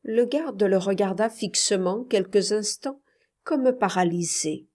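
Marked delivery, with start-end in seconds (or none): none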